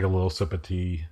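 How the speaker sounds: ordinary talk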